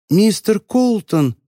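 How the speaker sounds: muffled, slow call from inside a suitcase; drawn-out call, high and dampened. A narrator's reading. Voice from the suitcase calling Frank, muffled. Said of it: "раздался приглушённый голос".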